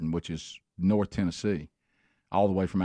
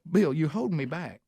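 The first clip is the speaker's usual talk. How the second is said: higher-pitched mock protest to a colleague; imitated coach complaint; 7 semitones higher than usual. Assistant coach griping to his head coach, mock complaint. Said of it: "You know, like that".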